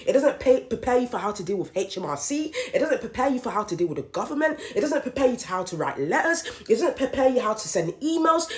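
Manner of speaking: normal talking voice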